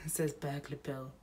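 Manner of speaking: plain reading voice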